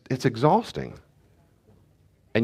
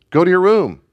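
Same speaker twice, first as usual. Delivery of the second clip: loud, higher stern command; stern order; 9 semitones higher, 8 dB louder than usual. Retold or imitated. Father ordering son to his room, loud stern command. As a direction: order him off firmly.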